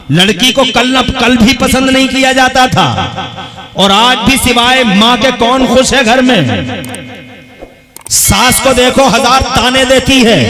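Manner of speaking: loud, high-pitched sermon delivery; high, ringing declamation; impassioned preaching. Acted. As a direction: preach it at full force to the crowd. Preaches at full force.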